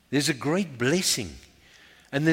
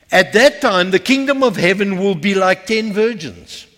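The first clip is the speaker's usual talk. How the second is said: forceful preaching; 6 semitones higher, 8 dB louder than usual. Acted. Preacher asking the church which five they are, forceful preaching. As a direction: proclaim the parable to a full church. Preaches the parable of ten virgins.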